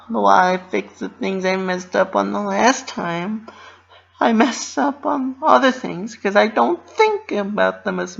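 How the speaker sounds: high, bouncy cartoon pony voice; Pinkie Pie voice. A narrator's reading. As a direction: voice a cheerful pony fretting to herself. Pinkie's inner monologue, high voice.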